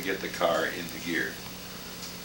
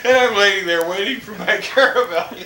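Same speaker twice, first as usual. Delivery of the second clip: high, laughing between phrases; amused real laughter; 10 semitones higher than usual. Real, not acted. Laughing at toll story.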